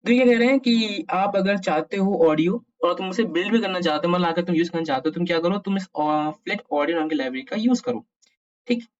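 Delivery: ordinary delivery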